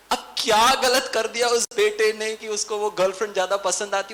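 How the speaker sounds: ordinary talk, nothing marked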